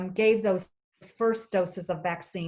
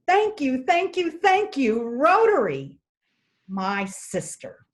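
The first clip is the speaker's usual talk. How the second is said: put-on high, louder, delighted child's thanks; excited child; 6 semitones higher, 6 dB louder than usual. Grinning little boy thanking President Jim, high excited voice.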